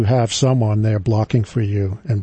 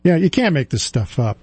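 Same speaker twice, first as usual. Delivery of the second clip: normal talking voice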